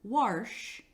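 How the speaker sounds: "warsh" with an r slipped in before the sh. Shown as a demonstration. Teacher demonstrating a dialect reading, saying "warsh". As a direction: say "warsh", rhyming with marsh. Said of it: "if a child reads the word wash as warsh".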